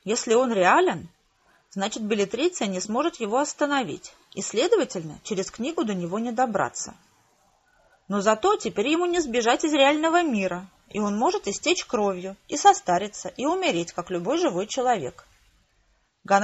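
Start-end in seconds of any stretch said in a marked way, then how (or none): none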